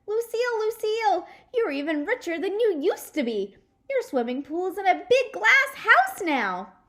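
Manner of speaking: high, loud gushing; thrilled girl. Acted. Junie B. to Lucille, a high loud gush. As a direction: squeal it out in delight.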